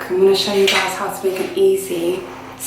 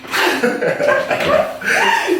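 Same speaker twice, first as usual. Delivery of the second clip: laughing; 10 semitones higher than usual. Real, not acted. Laughs at friend.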